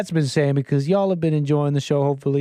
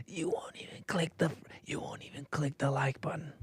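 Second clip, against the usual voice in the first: breathy stage whisper nagging to click like; 15 dB quieter than usual. Acted. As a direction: lean in and whisper the plea.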